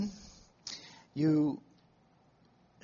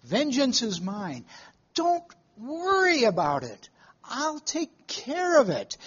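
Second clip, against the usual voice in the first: raised, higher, reassuring divine voice; voice of God; 7 semitones higher than usual. Acted. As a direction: speak as the Lord promising to take care of it. God speaks comfort to the worrier.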